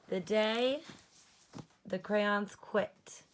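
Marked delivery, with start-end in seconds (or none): none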